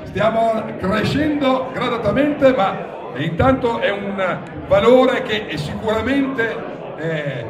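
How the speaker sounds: auctioneer calling bids. Real, not acted. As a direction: call out each bid to the room.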